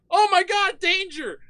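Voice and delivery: high-pitched comic panic; hammed-up frightened yelp; mock alarm. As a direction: sound a squeaky danger warning. Acts out his alarm at the shove.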